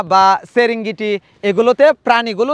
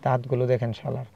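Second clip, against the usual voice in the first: softer, hushed so the lion stays; hushed awe; 7 semitones lower, 10 dB quieter than usual. Real, not acted. Vlogger beside a lion telling viewers, in a hushed voice. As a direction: keep the voice low and awed near the lion. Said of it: "আমরা এখানে কথা বলা যাবে না".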